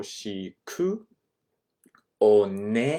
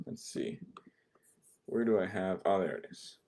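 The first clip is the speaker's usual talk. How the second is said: whispered aside; 8 dB quieter than usual. Real, not acted. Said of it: "looking for slide".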